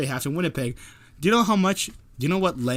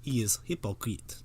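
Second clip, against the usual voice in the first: He is hypocrite in a mild mock French accent; 2 semitones lower, 6 dB quieter than usual. Still mimicking Bernier for his co-host, light French accent. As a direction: lightly imitate a Frenchman's broken English. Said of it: "That's his French accent".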